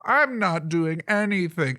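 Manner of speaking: hammed-up denial from a squirmy sidekick; indignant frog-like aide. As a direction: insist on innocence, stretched and high. Ribbit aide keeps protesting innocence.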